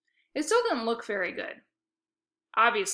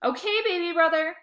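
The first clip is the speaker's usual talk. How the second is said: higher, louder mocking sing-song; teasing; 8 semitones higher, 5 dB louder than usual. A narrator's reading. Sister mocking her little brother. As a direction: taunt him sweetly.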